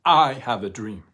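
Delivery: full-voiced proclaiming shout; top of his lungs. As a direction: boom it out to the crowd. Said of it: "At the top of his lungs".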